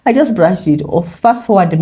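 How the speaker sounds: usual speaking voice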